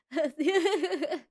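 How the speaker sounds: high, light giggle; real giggle. Real, not acted. Amused by the video presenter.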